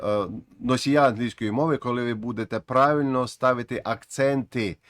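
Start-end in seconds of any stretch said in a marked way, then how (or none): none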